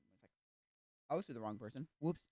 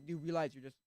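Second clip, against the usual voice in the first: usual speaking voice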